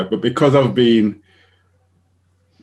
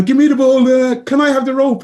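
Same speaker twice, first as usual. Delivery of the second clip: put-on much higher, louder child voices; playground kids; 12 semitones higher, 6 dB louder than usual. Imitating children in a primary school playground, high and loud. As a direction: squeal like kids at play.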